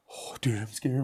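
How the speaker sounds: hushed frightened whisper; scared whisper. Real, not acted. Ghost hunt, scared in dark.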